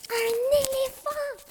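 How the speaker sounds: aigu, lent, ravi; s'emballer sur l'idée; exclamation enthousiaste. A narrator's reading. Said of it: "s'exclama Lapin". (Lapin s'exclame, ravi, à l'idée d'un éléphant)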